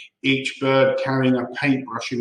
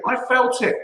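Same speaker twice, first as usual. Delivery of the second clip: indignant; 10 semitones higher, 3 dB louder than usual. A narrator's reading. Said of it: "shouted Mister Twit".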